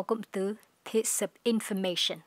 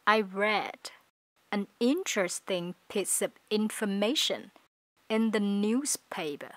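slow, careful, word-by-word reading; slow teacher demo of the sentence; slow model reading; slower than usual. Shown as a demonstration. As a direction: read it slowly for learners to copy. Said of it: "các bạn phát âm chậm với mình".

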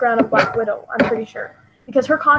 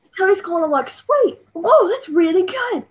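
a light put-on British accent, higher; mock British accent; 5 semitones higher than usual. Fan imitating Tom Holland's British accent, playful. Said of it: "He's like, he's like".

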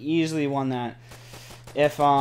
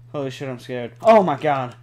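ordinary talk

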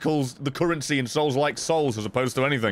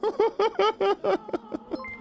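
high wheezing laugh burst; high cackling laugh. Real, not acted. Laugh alone on line.

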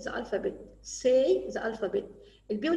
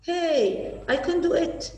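put-on higher, louder proud child; triumphant cheer; 6 semitones higher, 5 dB louder, slower than usual. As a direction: shout it out like you just won. Pupil Mona succeeds, cheering.

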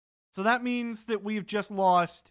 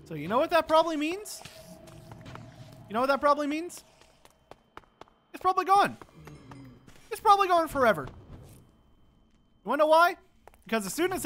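higher and louder, fed-up ranting; exasperated rant; 8 semitones higher, 4 dB louder than usual. Real, not acted. Gamer ranting to viewers about lost sword, exasperated. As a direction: vent at the game, pitch climbing.

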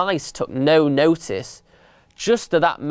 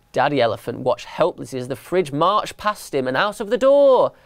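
ordinary delivery, nothing marked

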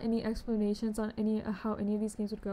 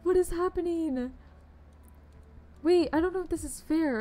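higher and louder, in disbelief; delighted shock; stunned exclamation; 6 semitones higher, 7 dB louder than usual. Real, not acted. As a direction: blurt it out, can't believe what you're seeing. Surprised by big trade offers.

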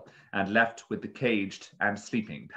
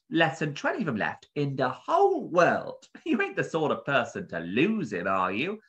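higher-pitched shopkeeper, pressing the customer; protective bird keeper; 6 semitones higher, 3 dB louder than usual. A narrator's reading. Bird keeper warning a buyer about a rare parrot, earnestly. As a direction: stress how precious the bird is.

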